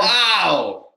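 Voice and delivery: loud, high burst of amazement; amazed wow. Real, not acted. Listener stunned by a friend's wild story, amazed. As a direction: let out a big, stunned wow.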